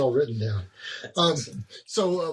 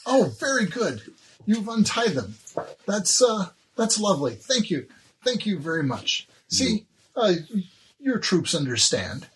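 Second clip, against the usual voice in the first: higher, pleased, courtly; gracious elf lady; 6 semitones higher than usual. Acted. The elf lady thanks the troops, pitched high and gracious. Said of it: "And she says".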